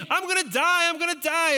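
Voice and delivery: high, loud repeated panic scream; screaming kid. Retold or imitated. Eight-year-old in the cave still screaming, loud and high. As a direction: wail it twice like a child in the dark. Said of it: "But I'm screaming".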